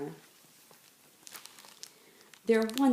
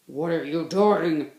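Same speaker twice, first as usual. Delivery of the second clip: gruff, louder bear challenge; growl; 3 semitones lower, 5 dB louder than usual. Acted. Other bears growling at Bunny Bear. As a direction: growl it at him. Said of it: "they growled".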